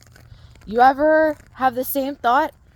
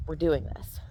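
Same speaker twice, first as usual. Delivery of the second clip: softer, low "We're doing this"; calm certainty, no push; quiet firm intent; 5 semitones lower, 7 dB quieter than usual. Shown as a demonstration. Trainer showing the intent behind the command, quiet. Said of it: "energetic intent of like".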